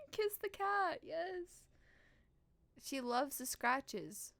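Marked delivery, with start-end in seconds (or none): none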